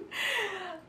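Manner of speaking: high, soft laugh; real high-pitched giggle. Real, not acted. Vlogger cracking up over the tags left on, high soft laugh. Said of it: "I'm laughing because".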